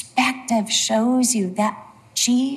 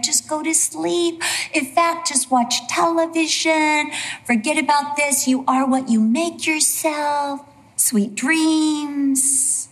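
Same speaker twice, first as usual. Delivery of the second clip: high singsong, mock-soothing; sugary reassurance, pitched up and drawn out; lulling false prophet; 5 semitones higher than usual. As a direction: lull them to sleep with false comfort. Voicing false prophets lulling people.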